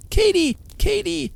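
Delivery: high, loud joyful call through the door. A narrator's reading. Mother calling her sleeping daughter, loud joyful call. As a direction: shout for the sleeping child. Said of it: "she screamed at the door".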